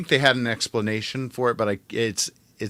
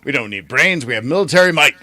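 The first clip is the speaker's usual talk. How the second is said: mock boast; 3 semitones higher, 8 dB louder than usual. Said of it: "they're just like".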